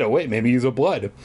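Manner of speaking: ordinary talk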